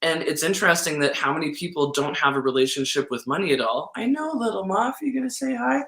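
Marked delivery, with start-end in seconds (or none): 4.0-5.8 much higher cooing pet voice. Real, not acted. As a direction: coo at the little dog. Talking to the pet dog.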